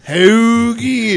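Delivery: loud, drawn-out mock Philly vowels; Philly-Pittsburgh accent. Host mocking the guest's dick-killer accent, exaggerated Philly accent. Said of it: "that Pittsburgh, Philly".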